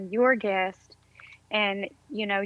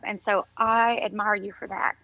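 ordinary talk

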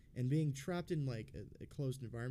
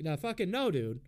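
higher, louder retold protest; indignant refusal; 6 semitones higher, 6 dB louder than usual. Patient refusing to wait for a phone call, indignant. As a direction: push back hard, pitch jumping up. Retold argument with hospital staff.

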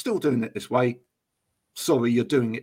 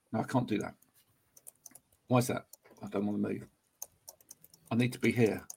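mock office worker; 5 semitones lower, 4 dB quieter than usual. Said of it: "when you say to them".

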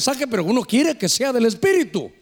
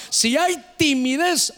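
normal talking voice

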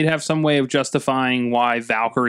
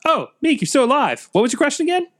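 much higher, light sing-song voice; high-pitched quote; 14 semitones higher than usual. Retold or imitated. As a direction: lift it high like a chirpy alien.

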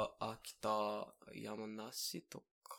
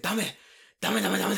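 loud, high repeated telling-off; rapid-fire mock outrage, pitched up; mock angry scolding; 8 semitones higher, 9 dB louder than usual. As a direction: imitate an outraged older man scolding. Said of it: "he's so mad at me".

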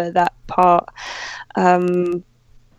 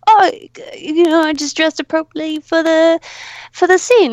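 high, rising sheepish voice; sheepish excuse; 9 semitones higher, 3 dB louder than usual. Retold or imitated. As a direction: play it innocent and a bit sheepish. Actor's sheepish excuse, retold.

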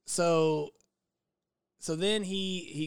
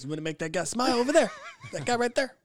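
laughing through the quote; 7 semitones higher, 6 dB louder, faster than usual. Retold or imitated. Retelling partner's line.

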